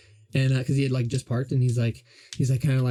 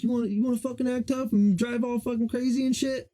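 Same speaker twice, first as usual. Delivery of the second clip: higher, aggressive imitation of a hostile stranger; retold taunt, pitched up and mocking; mock tough-guy taunt; 8 semitones higher than usual. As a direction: ham up the bully calling him over.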